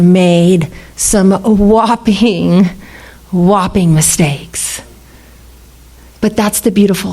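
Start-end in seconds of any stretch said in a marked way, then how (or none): 0.0-4.8 slow emphasis. Real, not acted. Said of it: "slow repeated emphasis".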